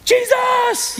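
sudden high shouted cry; crying out Jesus. Acted. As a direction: cry out the name in desperation. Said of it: "they cried out to the Lord".

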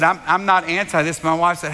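ordinary delivery

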